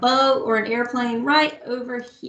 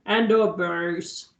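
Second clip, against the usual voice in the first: dropped low and slow for the bass; teacher showing the lowest recorder; 6 semitones lower, much slower than usual. Shown as a demonstration. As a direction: sink the voice down for the biggest recorder. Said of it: "the biggest one is the lowest".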